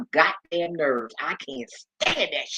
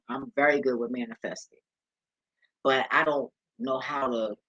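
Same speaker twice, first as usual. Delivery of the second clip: ordinary delivery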